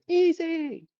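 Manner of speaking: a high, bright shout of recognition across a room; calling out a name. Retold or imitated. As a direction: call his name out joyfully from a distance. Woman calls out across church.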